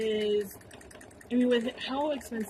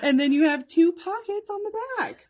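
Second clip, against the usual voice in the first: high, loud, quick through laughter; laughing; 8 semitones higher, 6 dB louder, faster than usual. Real, not acted. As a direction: keep presenting while cracking up. Laughing after her failed sheep joke.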